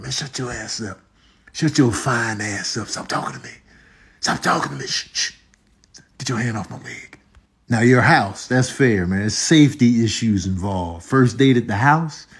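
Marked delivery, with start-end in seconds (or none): 0.0-7.2 half-whispered annoyed hush; hushed scolding. Acted. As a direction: scold under your breath. Acted hushing during a movie.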